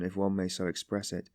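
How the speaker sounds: ordinary delivery, nothing marked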